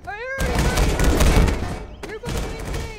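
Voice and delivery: loud, high-pitched yelling in character; frantic shout. Acted. Toy fighter yelling at his opponent, frantic shout. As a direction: shout it at the top of your voice.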